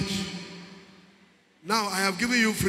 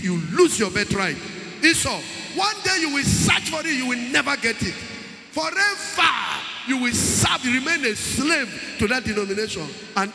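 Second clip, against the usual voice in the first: preaching at full force; 3 semitones higher than usual. Acted. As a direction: thunder the warning about the lost birthright.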